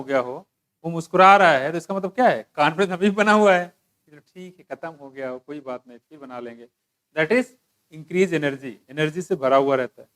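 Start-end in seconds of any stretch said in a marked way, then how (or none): 4.1-6.7 very soft, low, shrugging it off; hushed, easygoing acted reassurance; unbothered user. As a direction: murmur it as if nothing matters. Acting out unbothered, confident user.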